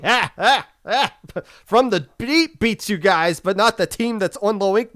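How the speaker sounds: high teasing ah ah ah; gloating laugh. Real, not acted. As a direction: laugh at them, taunting. Gloating at Marine Melody.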